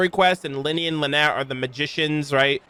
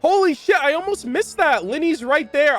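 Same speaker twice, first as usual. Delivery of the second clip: surprised; 11 semitones higher than usual. Real, not acted. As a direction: genuinely caught off guard. Suddenly spots Lyney on screen.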